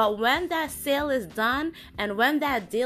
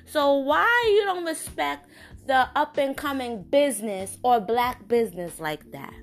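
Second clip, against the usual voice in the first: louder and higher, fed-up; heated rant; 6 semitones higher, 4 dB louder than usual. Real, not acted. Owner ranting to her audience, louder and higher. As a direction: push it out with real frustration.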